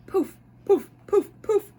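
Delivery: high, loud popping poofs; poof sounds. A narrator's reading. Mom reading to kids, loud high playful poof effects. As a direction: pop each unicorn away with a burst.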